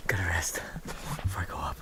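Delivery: breathless whisper after the climb; winded, barely voicing it; out of breath. Real, not acted.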